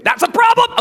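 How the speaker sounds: sudden high-pitched joke protest; mock outraged outburst. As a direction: burst out in pretend alarm, way up high. Mock outburst, retracted at once.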